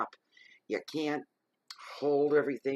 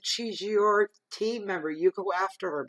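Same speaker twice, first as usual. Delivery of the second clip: retold yell; 7 semitones higher, 5 dB louder than usual. Retelling telling off her supervisor, loud. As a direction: lay the blame on him. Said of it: "I'm yelling at my supervisor".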